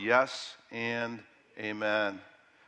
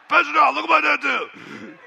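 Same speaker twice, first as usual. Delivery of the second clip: much higher, louder squeal with a laugh; excited congregant; 9 dB louder than usual. Pretend. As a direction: play a giddy churchgoer showing off. Joking congregant showing off tattoo.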